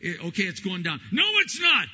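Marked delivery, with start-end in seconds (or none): 1.1-1.9 much higher, louder mock snap; wife's sharp retort. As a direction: cut him off sharply. Wife contradicts husband.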